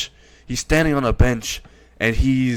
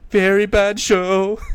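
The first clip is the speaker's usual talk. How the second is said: much higher, slow pained squeak; high pained Pops; 8 semitones higher, slower than usual. Acted. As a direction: wince it out in a high quavering voice. Pops gets kicked.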